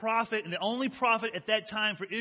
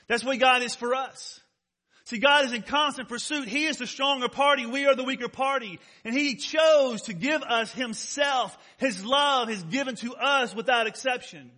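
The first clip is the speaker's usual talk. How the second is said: raised, emphatic pulpit delivery; preaching; 5 semitones higher, 6 dB louder than usual. Real, not acted. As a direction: drive each point home to the congregation.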